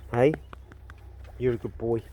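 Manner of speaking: ordinary delivery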